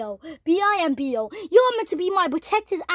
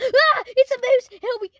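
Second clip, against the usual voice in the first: loud, high mock terror; panicked scream; 7 semitones higher, 5 dB louder than usual. Sheriff afraid of mooses sees one, play-acted panicked scream. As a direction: shriek and cry for help.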